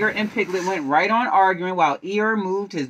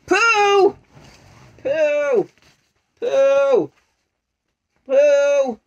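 loud repeated calls, first one high; calling out to Pooh; 6 semitones higher, 7 dB louder, much slower than usual. Acted. Rabbit calling Pooh over, loud calls. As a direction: hail him from afar.

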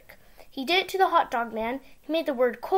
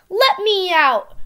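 trapped shout; 6 semitones higher, 7 dB louder, slower than usual. Acted. Gus trapped in jar.

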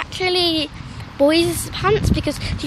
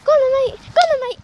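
high urging call to dog; 11 semitones higher, much faster than usual. Real, not acted. Urging the dog after squirrels.

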